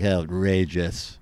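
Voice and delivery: low, slow, indignant "Outrageous"; mock outrage. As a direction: drag out "Outrageous" like an offended pundit. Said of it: "the other ones will be like".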